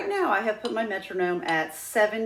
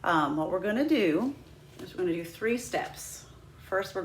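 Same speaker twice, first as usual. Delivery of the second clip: usual speaking voice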